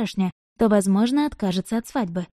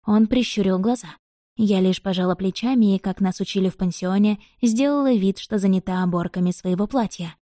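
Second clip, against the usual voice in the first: ordinary delivery, nothing marked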